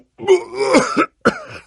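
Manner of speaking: harsh, high coughing fit; hacking cough. Acted. Sickly hero breaking into a hacking cough. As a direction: cough hard and rough.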